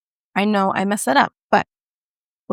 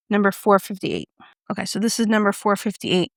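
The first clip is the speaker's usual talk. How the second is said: ordinary delivery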